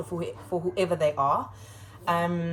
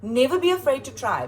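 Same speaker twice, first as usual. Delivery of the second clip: louder, higher teacher's encouragement; emphatic pep talk; 4 semitones higher, 5 dB louder than usual. Retold or imitated. Teacher telling kids who skip answers, emphatic encouragement. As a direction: urge it on the class with force. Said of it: "And I say to them".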